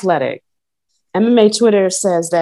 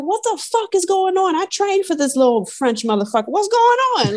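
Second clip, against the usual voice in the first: frustrated fighter; 11 semitones higher than usual. Retold or imitated. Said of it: "you could tell he was like".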